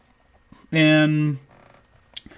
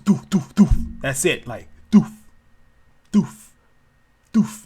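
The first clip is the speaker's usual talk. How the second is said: imitated landing thuds. Shown as a demonstration. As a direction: mimic heavy feet landing on a roof. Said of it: "they pretty much sound like doof".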